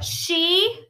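high, loud incredulous question; astonished cry. A narrator's reading. Rabbit learns the frog is a she, crying out. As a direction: cry out in disbelief. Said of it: "cried Rabbit".

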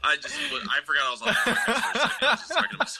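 giggling, pitched far up; embarrassed laughter mid-sentence; laughing. Real, not acted. Laughs off his mini breakdown.

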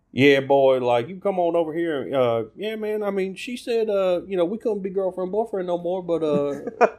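put-on high-pitched trashy Southern accent. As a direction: play a trashy Southern good old boy. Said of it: "pretty much universally trashy".

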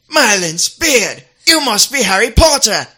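loud, amazed British film father; Amos Diggory. Acted. Wizard father meeting Harry, loud amazed impersonation. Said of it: "And then he goes".

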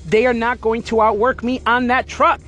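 ordinary talk, nothing marked

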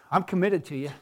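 low, soft, weary exasperated voice; a tired wife's flat sigh of a line. Retold or imitated. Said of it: "Usually she's exasperated with me".

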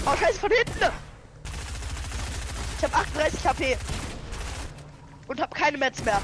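alarmed shout. Real, not acted. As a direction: panic as you get hit. Attacked from behind.

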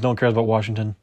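usual speaking voice

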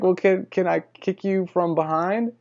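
high-pitched, put-on voice for her request; imitating tentative woman. Woman negotiates with the man paying her, imitated tentatively high. Voicing woman asking for easier terms.

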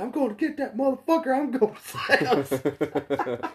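high, loud mock-angry quote; imitated huff, high-pitched and loud; pissed-off outburst. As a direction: act out his pissed-off rant, half laughing by the end. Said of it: "he got pissed off".

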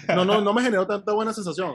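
deadpan fake dislike; mock denial. Sarcastic denial of liking trailer.

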